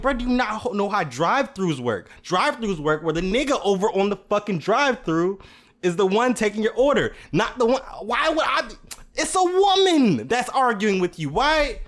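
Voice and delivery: high, loud incredulous yelling; retold rant at the customer, loud and high; angry yell. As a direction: shout back at her in disbelief. Yelling at lemonade-throwing customer.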